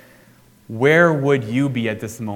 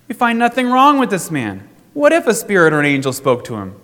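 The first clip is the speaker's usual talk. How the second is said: much higher, louder sharp protest; sharp retort; 7 semitones higher, 6 dB louder than usual. A narrator's reading. Scribes contending sharply with the council, high and loud. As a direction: snap back defending the man. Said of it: "contended sharply".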